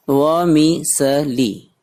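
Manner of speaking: "वमिसली" very slow, broken into wo-mi-se-li; syllable by syllable. Shown as a demonstration. Teacher models new word for students, "वमिसली" slowly syllable by syllable. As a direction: spell out "वमिसली" by sound, a gap after each syllable.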